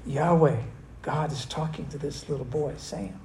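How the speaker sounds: Eli dropping to a soft, awed murmur; awed hush. Retold or imitated. Eli realizing God speaks to the boy, softly awed. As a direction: let the realization hush him to almost nothing. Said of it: "Eli wakes up and goes".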